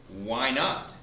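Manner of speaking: usual speaking voice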